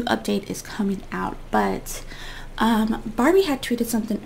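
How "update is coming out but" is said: hushed secret whisper. Real, not acted. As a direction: lean in and whisper it. Sudden whispered aside.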